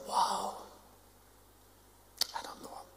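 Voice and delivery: near-silent whisper; hushed denial. Retold or imitated. Preacher whispering Peter's denial to the congregation. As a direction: mouth it under the breath.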